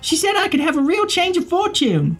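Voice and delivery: put-on very high, bubbly girl; young girl voice. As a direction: chirp it like an excited girl. GM voices Sandy.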